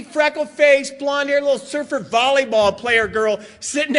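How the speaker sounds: ordinary delivery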